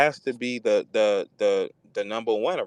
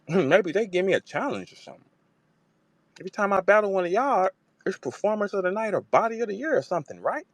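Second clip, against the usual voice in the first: imitating Jazz; 7 semitones higher than usual. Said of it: "She said".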